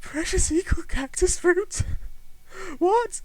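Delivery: disbelieving outburst; incredulous. Acted. Dying man balks at absurd item, high incredulous.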